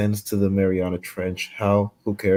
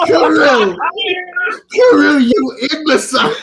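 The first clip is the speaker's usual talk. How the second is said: bellowing a name in outrage; furious shout; 10 dB louder, slower than usual. Acted. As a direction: yell it at full volume. Yelling at Kirill in chat.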